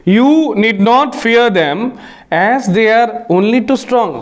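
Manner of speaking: very slow, clear reading of the example; deliberate classroom dictation pace. Shown as a demonstration. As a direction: read the quiz sentence word by word. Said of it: "एक example sentence है".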